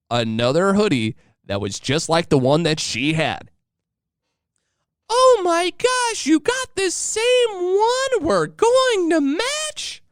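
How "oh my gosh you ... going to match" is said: very high, slow squealing mimic of wife; over-the-top excited wife. Husband imitates wife thrilled they match, high squeal. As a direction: stretch it out in a giddy high squeal. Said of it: "She was over the top excited".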